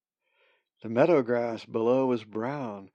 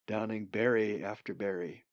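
usual speaking voice

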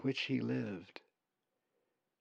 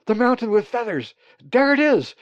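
very high, loud excited cries; happy croaking; 10 semitones higher, 10 dB louder than usual. A narrator's reading. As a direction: shout the discovery, bursting with joy. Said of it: "his voice croaking happily".

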